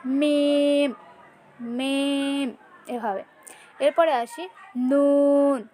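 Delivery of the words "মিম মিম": মিম with both lips just closed, slow; Arabic letter demo. Shown as a demonstration. Teacher showing students how to say মিম. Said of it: "দুই ঠোঁট just মিল করে উচ্চারণ করতে হবে".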